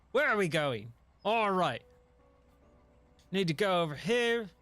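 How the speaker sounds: swaggering movie pirate voice; Jack Sparrow. Shown as a demonstration. Streamer demonstrating his impression for chat, Jack Sparrow voice. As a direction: ask it as a rakish pirate captain. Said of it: "not a lot of people can do a Jack Sparrow impression".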